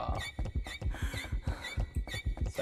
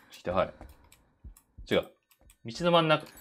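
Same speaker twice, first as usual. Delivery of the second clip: ordinary talk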